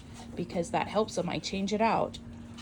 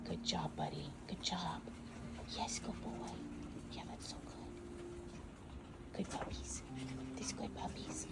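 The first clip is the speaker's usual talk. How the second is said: hushed, unvoiced, slow praise; softly whispering to puppies at the potty box; whispered praise; 14 dB quieter, slower than usual. Real, not acted. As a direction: praise them in a whisper so they stay calm.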